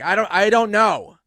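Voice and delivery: loud, high, drawn-out protest; retold flustered answer. Joker questioned by friend, loud flustered protest. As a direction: act helpless and flustered, at full volume.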